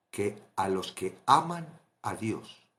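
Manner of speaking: normal talking voice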